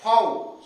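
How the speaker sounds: फाउ said loud, as faa-oo; German letter name. Shown as a demonstration. Teacher corrects students: the letter is फाउ, said loud. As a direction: call out फाउ loudly, not the English vee. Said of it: "this is not V".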